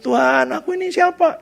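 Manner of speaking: high-pitched retold cry to God; tearful prayer. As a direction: imitate an overwhelmed tearful prayer, lightly. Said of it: "dia nangis gitu". (menirukan doa sambil menangis, nada tinggi)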